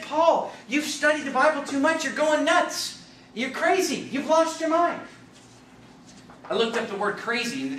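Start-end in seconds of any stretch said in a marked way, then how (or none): 0.0-5.0 high, emphatic rebuke; acted heated accusation. Accusers telling Paul he's lost his mind, heated rebuke. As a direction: accuse him in raised preaching voice.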